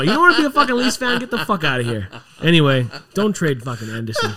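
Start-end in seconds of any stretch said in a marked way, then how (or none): 0.0-2.1 loud, high, fast telling-off; real anger, shouted; angry outburst. Real, not acted. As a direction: tell him to get out, fired up. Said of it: "You're angry".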